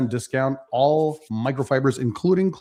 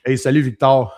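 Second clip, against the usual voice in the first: French accent; 7 dB louder than usual. Shown as a demonstration. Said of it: "You even have the accent".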